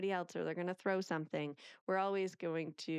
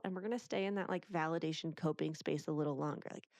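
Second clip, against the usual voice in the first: ordinary talk, nothing marked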